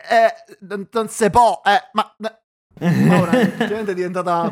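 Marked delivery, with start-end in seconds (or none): 0.1-2.4 heavy Roman dialect, slow and laughing; Roman accent. Retold or imitated. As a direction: caricature of a Roman girl, louder. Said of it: "devo farlo in romano". (accento romano caricato)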